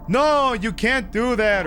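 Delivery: acting out James. Streamer imagining James's objection, slow, high mock protest. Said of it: "would P-, James have been like".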